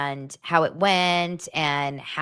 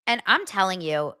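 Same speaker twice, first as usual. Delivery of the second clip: normal talking voice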